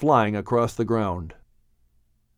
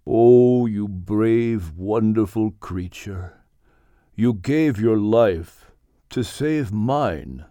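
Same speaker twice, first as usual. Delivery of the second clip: cradle the words like the dog itself; tender; 2 semitones lower, slower than usual. Acted. Rocking the dying dog.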